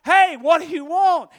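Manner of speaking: high, loud asking voice; acted calling question. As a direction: call it out as young Samuel. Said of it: "he's like".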